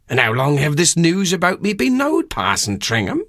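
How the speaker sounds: a country haggler marvelling at his own grandeur; amazed rustic countryman. Acted. Durbeyfield hears he is a D'Urberville, rustic and astonished.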